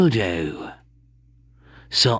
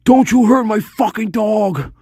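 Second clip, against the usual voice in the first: high, loud surfer-slash-cocaine accent; Buffalo Bill; 10 semitones higher, 5 dB louder than usual. Shown as a demonstration. Streamer demonstrating the movie killer's accent, high surfer-style shout. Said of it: "So it's like this".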